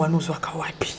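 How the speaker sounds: breathy whispered aside; hushed, secretive whisper. Real, not acted. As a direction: slip the question in under your breath. Host's aside question, then asked aloud.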